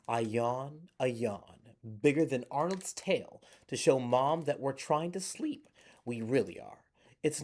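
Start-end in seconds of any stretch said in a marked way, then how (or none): none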